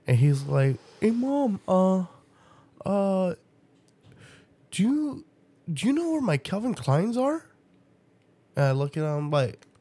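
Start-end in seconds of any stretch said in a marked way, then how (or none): 1.0-7.5 high, childish mock kid voice; imitating her son. Mother imitating her son asking for his shoes, high mock kid voice. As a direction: play the clueless kid, pitched way up.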